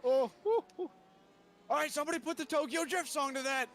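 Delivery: high, loud cheering hoots; excited whooping. Real, not acted. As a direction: cheer it like a wild drift. Cheering a drift.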